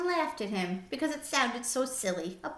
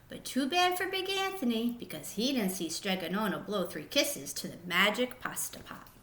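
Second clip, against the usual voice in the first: plain reading voice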